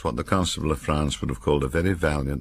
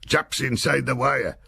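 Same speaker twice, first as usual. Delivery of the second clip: higher, slow called warning; passed-on alarm; 11 semitones higher than usual. A narrator's reading. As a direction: call the alarm down the line. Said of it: "and then the word".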